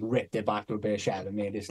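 ordinary talk, nothing marked

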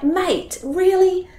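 put-on high, exasperated challenge to a child; mock incredulity. Mum questioning her son's change of mind, mock incredulous. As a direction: jump up in pitch, lightly incredulous.

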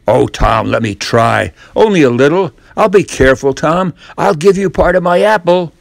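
pleading Ben. Acted. Ben begging Tom to let him paint, eager pleading. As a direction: beg like a desperate boy.

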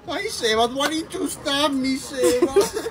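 high, loud wail of blame; overdramatic, high-pitched complaint; hammed-up regret. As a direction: wail it at him in mock despair. Wife blames husband after eating.

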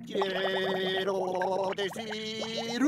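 muffled talking underwater; slow, muffled words through water; underwater shouting. Real, not acted. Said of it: "probar que hablaba bajo el agua".

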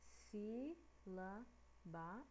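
si, la, ba said as separate beats; syllables one by one. Shown as a demonstration. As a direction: split the word si, la, ba into clean syllables. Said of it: "E aqui".

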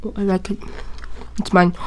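ordinary talk, nothing marked

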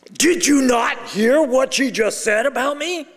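a furious husband shouting at the mentor; angry retold shout. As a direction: shout it like you've been wronged. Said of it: "I'm absolutely flaming mad".

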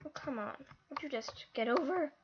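normal talking voice

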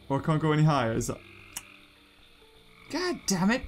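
ordinary talk

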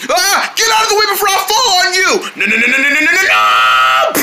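high, fast panicked yelling; panicked shout. Acted. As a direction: yell a warning in panic. About to fall on partner.

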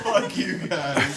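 high laughing outburst. Real, not acted. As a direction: laugh it out loud. Laughing at the taped-up gift.

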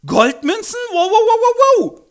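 extrem hoch und laut, halb lachend; gekreischtes Wow. Acted. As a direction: kreischt vor Freude über Gold. Eliza über Goldmünzen. (freudiges Kreischen)

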